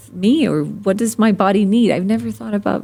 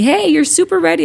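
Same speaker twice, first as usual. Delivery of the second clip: high, loud, quick gadget cheer; chirpy app voice; 9 semitones higher, 4 dB louder, faster than usual. Retold or imitated. Sleep app telling her she is super ready, high and bright. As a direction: play the upbeat app announcing readiness. Voicing the sleep app's verdict.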